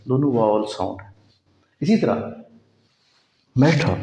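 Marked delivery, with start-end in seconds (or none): 3.6-3.9 मैठा with a hard ठ, no r; British matter. Shown as a demonstration. Teacher showing British matter. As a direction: say मैठा, aspirated t. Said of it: "ब्रिटिशर इसको मैठा कहते हैं".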